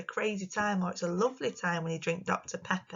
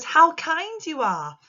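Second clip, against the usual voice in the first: higher, louder exclamation read slowly; 8 semitones higher, 9 dB louder, slower than usual. Shown as a demonstration. Teacher reading an example to pupils, expressive. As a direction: read it out with feeling. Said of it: "And this one says".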